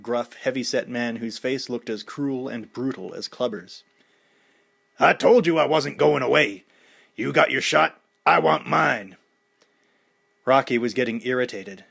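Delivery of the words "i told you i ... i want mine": loud, menacing challenger; a hint of a brash fighter calling out the champ; Clubber Lang. A narrator's reading. Clubber challenging Rocky at the dedication, aggressive.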